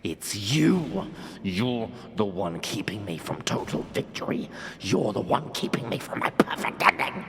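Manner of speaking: enraged king shouting at Siffrin; furious king. Acted. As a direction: spit the accusation out.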